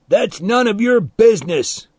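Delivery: high, loud indignant retort; shouted back. A narrator's reading. Milo answers departing boy's jab, shouting back. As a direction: yell it back defensively. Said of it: "Milo shouted back".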